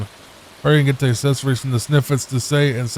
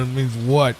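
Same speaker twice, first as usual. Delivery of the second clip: normal talking voice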